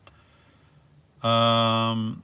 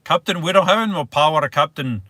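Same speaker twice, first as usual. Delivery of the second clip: quick, raised-pitch attempt at Scottish; terrible Scottish accent; 4 semitones higher, 3 dB louder than usual. Shown as a demonstration. Livestreamer retrying the line for viewers, terrible Scottish accent. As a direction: have a hurried second go at a bad Scottish accent. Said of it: "A terrible accent".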